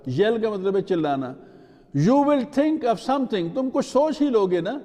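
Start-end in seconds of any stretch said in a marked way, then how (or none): 2.0-4.8 raised, higher voice, understated yell; mild yell back. A narrator's reading. Rosie answers the one who yelled, mild yell back. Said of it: "Rosie yelled back".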